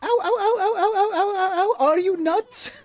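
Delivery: high repeated pained ows; yelping in pain. Acted. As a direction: yelp like you got stepped on. Issun squashed, yelping.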